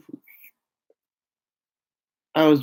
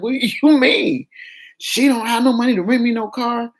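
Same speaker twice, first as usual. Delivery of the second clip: incredulous; 7 semitones higher than usual. Retold or imitated. Disbelief at girl's excuse.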